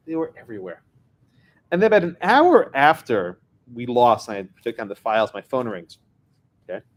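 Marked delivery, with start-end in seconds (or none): 0.1-0.8 softer, level echo for effect; understated, softly restated aside; subdued repeat. Real, not acted. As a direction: let it drop, quieter. Softer echo of previous line.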